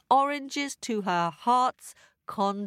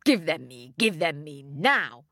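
low, greedy demanding voice; wicked stepmother; 5 semitones lower than usual. A narrator's reading.

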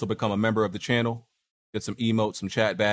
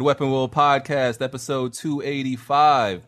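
announcer; 3 semitones higher, 4 dB louder, slower than usual. Acted. Podcast opener announcement.

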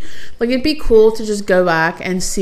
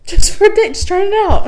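teasing echo; 13 semitones higher, 5 dB louder than usual. Real, not acted.

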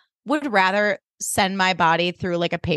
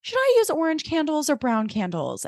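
breezy evasive Kirsten; 8 semitones higher, 3 dB quieter than usual. Retold or imitated. Kirsten dodging with candles question.

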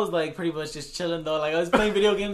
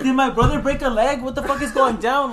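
mock panic; 7 semitones higher, 5 dB louder than usual. Retelling panicked inner reaction.

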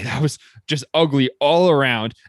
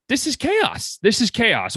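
exasperated; 6 semitones higher, slower than usual. Real, not acted. Exasperated at trade deadline.

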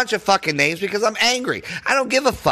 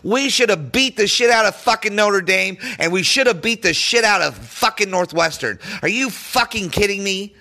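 ordinary talk

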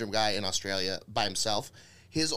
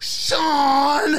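hammed-up furious yell, much higher and louder; mock angry shout; 13 semitones higher, 10 dB louder than usual. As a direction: scold Sean in mock outrage. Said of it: "he's so mad".

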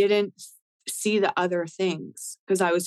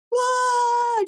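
much higher and louder mock crowd cheer; crowd whoa; 4 dB louder than usual. Imitating the crowd's roar after her rap verse, loud whoa. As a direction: whoop like an amazed audience. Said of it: "And the audience went".

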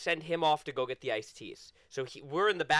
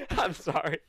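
apologizing through laughter; laughing apology; 3 semitones higher than usual. Real, not acted. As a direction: giggle the apology out.

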